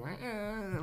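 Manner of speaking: fading feeble cat cry; imitated dying cat cry. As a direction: mimic a cat's faint dying sound. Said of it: "and she, like".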